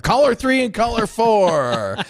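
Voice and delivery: slow, drawn-out announcer call; dramatic announcer voice. Pretend. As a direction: stretch it out like a big radio reveal. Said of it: "we lost our lawnmower sound effects, so guess what?".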